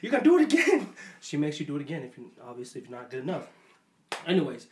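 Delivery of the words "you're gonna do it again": high-pitched demanding mom; mock coaching, insistent and high; imitating mom. Mom demands a scary performance, high imitation. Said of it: "She's like".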